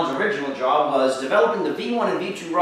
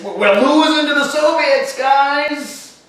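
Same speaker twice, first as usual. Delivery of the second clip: mock alarm; 9 semitones higher, 7 dB louder, slower than usual.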